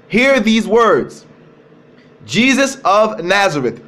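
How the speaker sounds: each word slowed and spaced out; slow, weighty reading. Shown as a demonstration. Preacher telling the listeners to listen, slow weighty reading. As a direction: draw out Hear these words for the congregation. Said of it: "I want you guys to listen".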